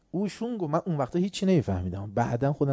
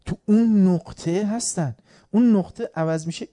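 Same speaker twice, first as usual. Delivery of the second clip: ordinary delivery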